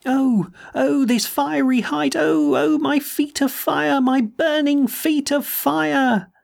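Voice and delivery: high, slow, faraway cry of anguish; a lost man's high drawn-out lament; anguished wail. A narrator's reading. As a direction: wail it from the sky, pleading and in pain. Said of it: "calling in tones of indescribable anguish and appeal".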